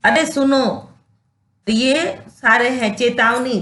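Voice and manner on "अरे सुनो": calling out. Shown as a demonstration. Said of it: "बोलते हैं ना".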